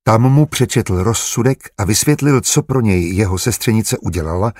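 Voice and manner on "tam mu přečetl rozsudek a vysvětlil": plain reading voice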